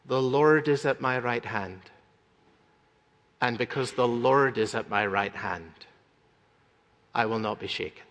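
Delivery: low, soft, calm affirmation of faith; quiet trust. Retold or imitated. Preacher to congregation, voicing a humble believer in soft calm trust. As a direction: let it settle, gently and sure.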